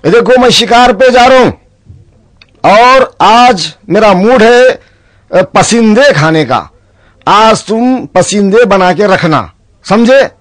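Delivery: high, slow lordly orders; commanding nawab. Acted. Nawab ordering his servant before hunting, slow and lordly. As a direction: lay down the order grandly and slowly.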